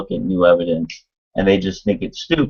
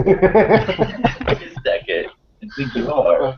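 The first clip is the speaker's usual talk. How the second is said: laughing; 6 semitones higher, slower than usual. Real, not acted. Laughing at accent joke.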